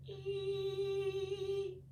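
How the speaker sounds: a thin, high sung "ee"; high flute note. A narrator's reading. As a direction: imitate a single flute note. Musical note sound.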